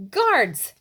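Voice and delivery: very loud high call; king calling guards. Acted. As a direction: summon the guards across the hall. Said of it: "called the king".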